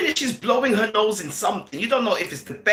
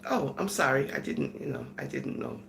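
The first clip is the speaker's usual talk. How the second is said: low, trailing mock apology; embarrassed onlooker sinking into a mumble; sheepish apology; 9 semitones lower, 7 dB quieter than usual. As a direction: back down softly, dropping in pitch. Acted onlooker backs down, embarrassed.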